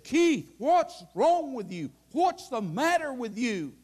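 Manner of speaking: high, startled cry of alarm; a shocked girl asking what's wrong; shocked girlfriend. Retold or imitated. Girlfriend shocked at Keith weeping, high startled voice. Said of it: "she looked at me in shock and she said".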